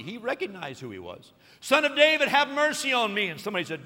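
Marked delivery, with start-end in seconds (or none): 1.6-3.3 high, loud pleading call; crying out. Retold or imitated. Blind beggar calls to Jesus again, loud pleading. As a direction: keep crying out, begging. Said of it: "it gets louder and louder".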